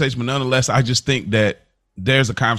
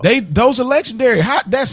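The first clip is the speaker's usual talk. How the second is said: pitched way up, insisting those are legendary; emphatic insistence; 13 semitones higher than usual. Real, not acted. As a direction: push the point hard with rising excitement. Insisting in a heated debate.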